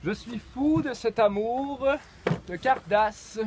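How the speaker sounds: high, slow dramatic declaiming; poetry recital. Acted. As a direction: recite it like an overwrought poet. Declaims poem as he sees it.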